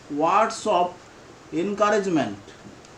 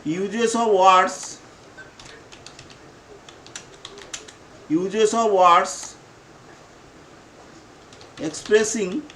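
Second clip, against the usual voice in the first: slow dictation; slower than usual. Shown as a demonstration. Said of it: "dictating slowly to class".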